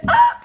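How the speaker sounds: high ticklish shriek; sudden squeal of laughter; being tickled. Real, not acted. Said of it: "séance chatouillage".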